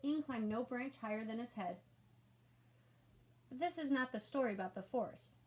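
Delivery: usual speaking voice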